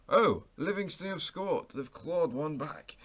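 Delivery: put-on English commentator voice, high and drawn out; mock a commentator belatedly noticing in an English accent. Mimicking radio commentators who noticed the goal late, in an English accent. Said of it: "put on an English accent".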